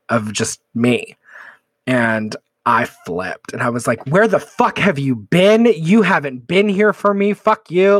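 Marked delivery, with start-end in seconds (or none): 4.1-8.0 replaying a furious yell, raised and louder; retold screaming fight. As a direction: throw the anger back at a friend who left. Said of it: "she's screaming at me".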